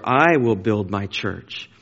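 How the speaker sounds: slow line with a long, leaning I; stress on I. Shown as a demonstration. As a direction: lean on "I", let the rest follow slowly. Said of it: "I emphasized it on purpose".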